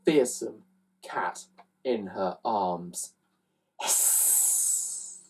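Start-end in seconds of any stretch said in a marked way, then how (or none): 3.8-5.2 long unvoiced hiss; long cat hiss. Aunt's fearsome cat hisses.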